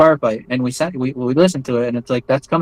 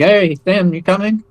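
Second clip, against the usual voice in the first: higher-pitched retold call to a comrade; lightly acted "are you joining us" call, pitched up; 6 semitones higher than usual. Soldiers ask crying comrade to join them, calling out.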